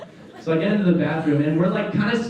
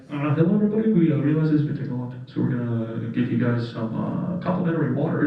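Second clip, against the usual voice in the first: lightly mocked cheery intercom captain; a hint of upbeat pilot offering water; pilot announcement; 2 semitones lower than usual. Pilot offers passengers free water, mock-cheery. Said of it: "he's like".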